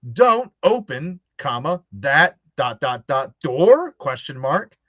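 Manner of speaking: slow, choppy read with each comma and dot spoken; odd stilted reading. Retold or imitated. Mocking the old game cast, slow stilted punctuation read. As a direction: read it like a bad actor reading punctuation aloud. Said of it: "wrote all the commas and periods".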